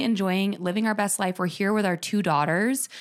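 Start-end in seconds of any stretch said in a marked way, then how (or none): none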